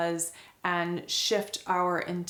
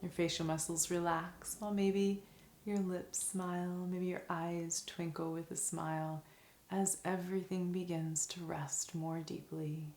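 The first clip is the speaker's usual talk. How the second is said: soft, calm meditation voice with long pauses; guided meditation; 2 semitones lower, 7 dB quieter than usual. Acted. Teacher guiding listeners through a five senses meditation, soft and calm.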